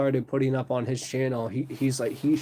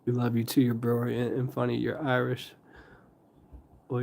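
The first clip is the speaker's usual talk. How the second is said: normal talking voice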